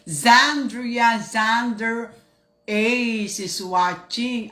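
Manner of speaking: slow, drawn-out name call; announcing name. Acted. Host greeting a viewer, slow announcer-style name call. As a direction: stretch each syllable like a host.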